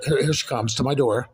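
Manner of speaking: ordinary talk, nothing marked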